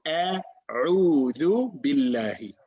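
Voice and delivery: slow, drawn-out recitation with room between sounds; unhurried teaching recitation; taking time. Shown as a demonstration. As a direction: recite it slowly, pausing to place each throat letter. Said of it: "take your time. You can pause".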